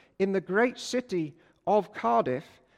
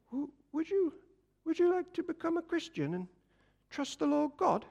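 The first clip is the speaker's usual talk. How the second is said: high, soft, halting little voice, slowing at the end; a timid boy forcing the words out one by one; nervous stammer; 8 semitones higher, 5 dB quieter than usual. Retold or imitated. Nervous Jack asks the man to church in a soft, high stammer.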